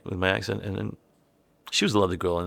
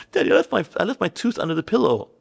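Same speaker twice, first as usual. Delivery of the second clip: put-on high-pitched child voice; Kea; 10 semitones higher, 3 dB louder than usual. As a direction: imitate a kid eagerly telling dad. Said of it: "Kea came in".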